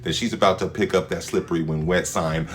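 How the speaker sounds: usual speaking voice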